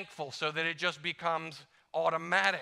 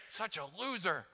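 self-insult; 3 semitones higher, 6 dB quieter than usual. Retold or imitated. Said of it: "I say that to myself".

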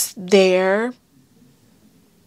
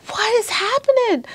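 retold panicked cry; 13 semitones higher than usual. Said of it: "and I'm just like".

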